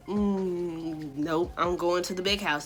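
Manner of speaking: sinking, defeated murmur; resigned dread. Retold or imitated. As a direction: act out glum acceptance of doom. Acted resignation at police arriving.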